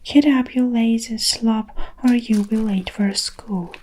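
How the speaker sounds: ordinary delivery, nothing marked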